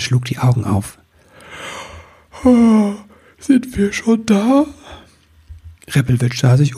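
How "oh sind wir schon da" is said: high, slow drowsy little voice; yawning wichtel woman; sleepy wake-up. Acted. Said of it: "Da gähnte sie".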